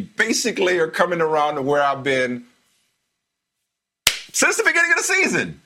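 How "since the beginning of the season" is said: much higher-pitched, insistent crow; emphatic crowing. Real, not acted. Host rubs it in that co-host came around, emphatic crowing. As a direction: ring it out high, as vindication.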